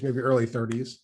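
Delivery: normal talking voice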